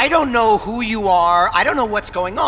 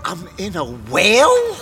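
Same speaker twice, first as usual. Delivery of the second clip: shocked shout. Acted. Realizing he is inside a whale.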